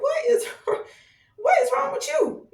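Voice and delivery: high, incredulous what-is-wrong-with-you, half laughing; exasperated disbelief. Retold or imitated. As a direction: act out baffled outrage with a laugh in it. Mock exasperated reaction to someone cussing.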